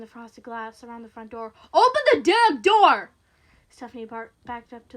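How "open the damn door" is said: slow, high, very loud yell; enraged command; furious shout. Acted. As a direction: bellow each word through the door. Man pounding on door shouts.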